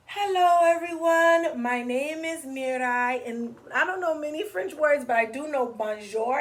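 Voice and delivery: put-on high, loud, excited little girl; eager kid at show and tell; child presenting. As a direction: present it proudly to the class.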